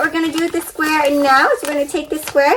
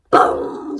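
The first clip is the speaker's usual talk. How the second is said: whispered boom. Acted. Thunder in the story.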